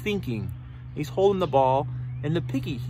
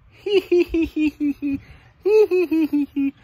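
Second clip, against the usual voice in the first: high, rapid "hee-hee" giggle; snake laughing; 6 semitones higher, 3 dB louder than usual. Acted. Snake says he has no arms, laughing. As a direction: pretend titter in two bursts, pitched up. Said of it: "He's laughing".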